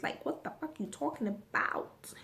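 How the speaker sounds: breathy whisper, no voice; incredulous whispered comeback; whispered retort. Retold or imitated. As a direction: hiss the last jab under your breath. Ending retort to cousin.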